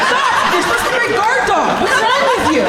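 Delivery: high, loud panicked scolding; mock scolding. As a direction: yell at the dog in disbelief. Scolding humping dog at job.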